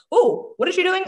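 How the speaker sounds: high, curious exclaim; suddenly hooked and curious; perked-up curiosity; intrigued. Retold or imitated. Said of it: "give them something that they are like".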